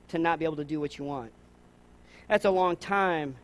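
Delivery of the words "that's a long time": slow refrain. Real, not acted. Refrain slowed.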